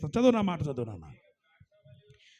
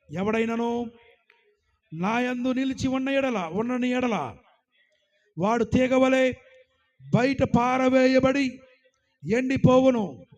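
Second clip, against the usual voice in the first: loud verse reading; 4 semitones higher, 6 dB louder, slower than usual. Acted. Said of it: "ఏమంటున్నాడు ఇక్కడ?".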